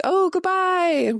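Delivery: high, drawn-out singsong sign-off; breezy high-pitched wave-off; carefree farewell. Acted. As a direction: sing out a long, lilting send-off. Voicing the brain on alcohol.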